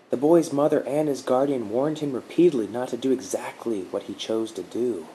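a hint of a calm protector reassuring him; gentle guardian woman. A narrator's reading. Guardian explains calmly.

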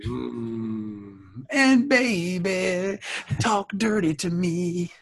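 slow, sultry mock voice; seductive voice. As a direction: drawl it out seductively.

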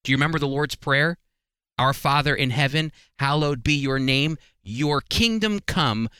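ordinary talk

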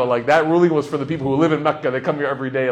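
usual speaking voice

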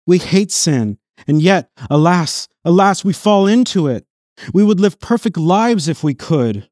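preacher pleading over sin, full force; preaching at full force. Acted. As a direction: drive the words home to the congregation. Impassioned reading of commentary.

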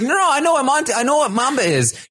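high-pitched defensive backtracking; mock flustered. Ally insists he knows Mamba, flustered. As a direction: imitate someone hurriedly covering confusion.

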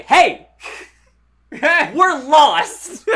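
loud, high hollered catchphrase; mock yelling. Two creators hail the studio, yelling. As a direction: call it out like an over-eager duo announcing themselves. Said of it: "they just start yelling".

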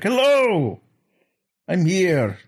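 high, drawn-out mock showbiz greeting; showy stage entertainer. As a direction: belt it out like a hammy one-man show. Imagined Moffat one-man stage show.

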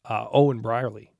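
usual speaking voice

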